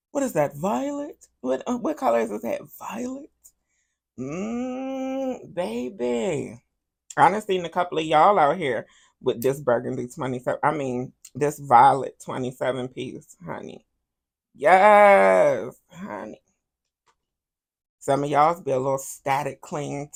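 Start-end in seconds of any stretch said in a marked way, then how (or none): 0.1-6.6 mock disbelief. Real, not acted. Mock shock at purple hair color. 14.5-16.4 loud drawn yes. Real, not acted.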